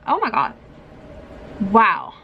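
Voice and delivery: ordinary delivery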